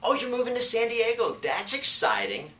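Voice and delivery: excited echo of a buyer's move; excited reply. Shown as a demonstration. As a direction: sound thrilled for the buyer's news. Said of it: "See, by repeating and affirming".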